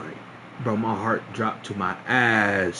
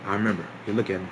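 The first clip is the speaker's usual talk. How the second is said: ordinary talk, nothing marked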